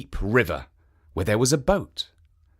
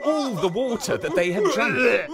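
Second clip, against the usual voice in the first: higher, laughing through the narration; laughing while telling; 7 semitones higher than usual. A narrator's reading. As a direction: let the laughter spill into the telling. Oni burst out laughing.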